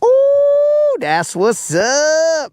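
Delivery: very high loud "Ooh", then drawn-out cheer; excited whoop. Real, not acted. Celebrating the catch at the top of the hour, excited whoop. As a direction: whoop with delight, then savor it.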